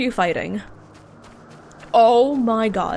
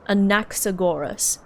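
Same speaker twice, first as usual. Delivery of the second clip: Anaxagoras with the punch on "nax"; stress on second syllable. Shown as a demonstration. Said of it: "put stress on the N A there".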